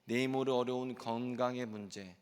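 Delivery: ordinary delivery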